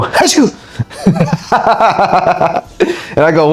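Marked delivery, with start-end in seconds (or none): 0.0-0.5 sudden high mock sneeze; high sneeze played for a joke; fake sneeze. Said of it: "she would go". 0.8-3.1 real laugh at the memory. Real, not acted. Laughing at his own story.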